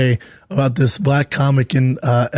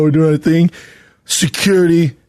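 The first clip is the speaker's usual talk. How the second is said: usual speaking voice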